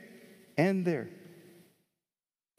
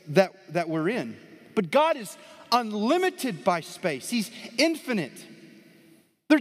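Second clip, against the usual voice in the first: raised, louder preaching voice building up; full-force preaching; 6 semitones higher, 3 dB louder than usual. Acted. Pastor preaching to the congregation, raised and forceful. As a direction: preach it out to the room, rising high.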